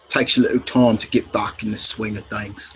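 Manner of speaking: attempted Cockney butler; understated Cockney impression; Cockney accent. Shown as a demonstration. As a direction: reassure in a low-key Cockney accent. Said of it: "that's kind of a Cockney accent".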